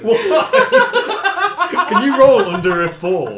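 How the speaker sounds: high, loud laughing shouts; laughing loudly. Real, not acted. GM laughing at the dice roll, loud and high.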